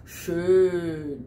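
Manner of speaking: Schön with a long ö; long vowel. Shown as a demonstration. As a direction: stretch the ö in Schön. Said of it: "vai ser longo".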